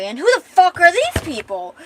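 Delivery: loud, high outburst of disbelief; indignant demand. Acted. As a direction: demand to know about these strangers. Confused woman demands who strangers are.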